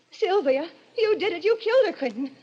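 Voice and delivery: high, trembling accusing cry; frightened accusation. Acted. Kate accusing Quentin of murder, high and scared. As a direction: accuse him through the fear.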